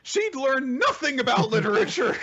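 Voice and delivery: very high, put-on chatter; joking character voice. Co-host riffing as a character about another timeline, high put-on voice. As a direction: pitch it way up, mock-casual.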